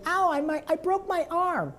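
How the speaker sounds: high cartoon kid complaining; Carl's voice. Carl reporting a broken arm, high whine. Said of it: "It's not like saying".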